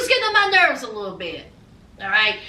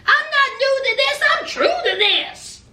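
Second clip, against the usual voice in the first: mock-cocky mom voice; confident mom boast; 10 semitones higher than usual. June's mom boasting as she drives. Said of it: "She was like".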